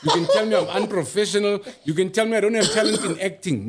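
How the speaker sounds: laughing through the words; laughing while talking. Real, not acted.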